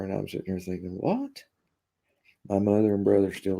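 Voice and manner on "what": incredulous what. Retold or imitated. Retelling the buyer's insult.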